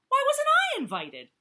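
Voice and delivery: very high, loud whine; jealous Jackal whining about being left out. Acted. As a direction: wail the complaint like a spoilt child. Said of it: "whined Jackal".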